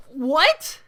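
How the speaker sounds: loud, high, baffled what; stunned, indignant what; incredulous what. Real, not acted. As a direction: react in total disbelief. Disbelief at teacher's comment.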